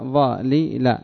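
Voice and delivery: "ظالل" slowly, in three separate syllables. Shown as a demonstration. Teacher explaining the verb's origin, syllable by syllable. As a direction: pull "ظالل" apart sound by sound. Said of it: "فأصلها".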